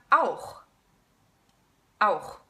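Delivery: auch ending in a throaty ch; German auch. Shown as a demonstration. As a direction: rasp the ch in auch. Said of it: "a couple of examples".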